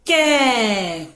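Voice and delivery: "कै" drawn out long with a falling tone; ai-sound demonstration. Shown as a demonstration. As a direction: stretch the vowel in "कै" for learners. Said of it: "तो इसे हम क्या बोलेंगे".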